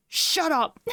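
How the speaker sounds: high-pitched mock "zip it" outburst. Streamer acting out viewer interrupting the monologue, mock-annoyed outburst. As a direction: cut in with a playful yelp. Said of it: "it looks like you're like".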